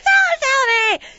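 very high, loud mock pleading; hammed-up shouted demand. Traveller pressing the guy at the counter, hammed-up shout. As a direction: yell it like an overwrought movie hero.

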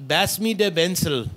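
mock Indian accent, "Bensel" for pencil. Shown as a demonstration. Showing how to ask for a pencil, Indian accent. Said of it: "It's a P's or B's".

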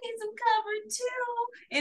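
put-on small, high, whimpering baby plea; a hurt toddler asking his brother for cover; baby Tommy voice. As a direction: beg for the blanket like a cold one-year-old. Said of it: "he was like".

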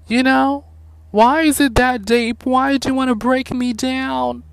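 high, fed-up voice telling off a customer; high-pitched mock rant. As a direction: squeal it out in disbelief. Ranting at a rude customer.

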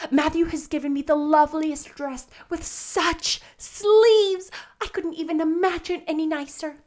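high, loud, gushing greeting; exuberant excited girl. Acted. Anne tells Diana about her new dress, overjoyed. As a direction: burst out with the news, thrilled.

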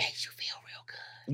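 unvoiced breathy whisper. Real, not acted. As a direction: whisper it like a secret. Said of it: "why you whispering".

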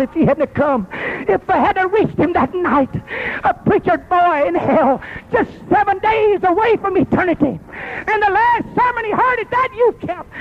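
sermon voice climbing in pitch; preaching. Acted. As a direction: preach it as the story takes off. Preacher rises toward the altar call.

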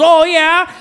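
high, loud, drawn-out movie impression; hammed-up high Irish waitress, laughing at the end; mock Irish waitress. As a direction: squeal it like a scorned Irish girlfriend. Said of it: "she's some Irish ... she goes".

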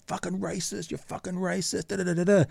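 soft, high-pitched mock jeering; mock heckling. Imitates passing protesters' insults.

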